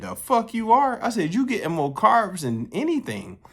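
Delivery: high-pitched mock outrage; retold incredulous rebuttal. Retold rebuttal to friend's protein claim.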